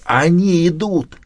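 high, slow stage whisper; hoarse whisper. A narrator's reading. Ramilius warning that they are coming, slow hoarse whisper. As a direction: hiss a drawn-out hoarse warning. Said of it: "услышал хриплый шёпот Рамилия".

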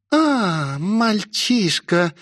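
высоко, медленно, с рыком; растяни слова, рыча как демоница; рычащая демоница. A narrator's reading. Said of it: "прорычала демоница". (рычание демоницы, нараспев)

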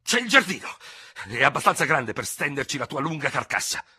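high, loud burst of fury; impetuous sputter. A narrator's reading. Ferraud proposes the garden for the duel, sputtering impetuously. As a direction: blurt it out with furious momentum. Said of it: "farfugliò l'altro con tanto impeto".

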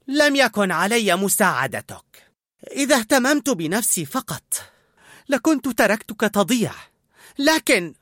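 fighting tears mid-sentence; holding back tears. A narrator's reading. Colette to Linguini, fighting tears. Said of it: "وهي تحبس دموعها".

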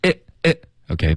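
usual speaking voice

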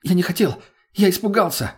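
high-pitched, panicky excuse; frightened pleading. A narrator's reading. Captured mage pleads for life.

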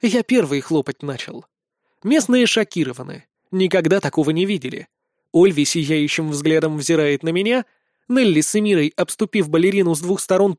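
ordinary delivery

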